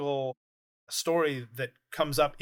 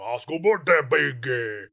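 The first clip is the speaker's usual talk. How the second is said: Jabba voice; 6 dB louder, slower than usual. Retold or imitated. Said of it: "and Jabba's like".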